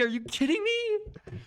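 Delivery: very high, amazed disbelief; shrieking are you kidding me; incredulous squeal. Real, not acted. Stunned at the chess position.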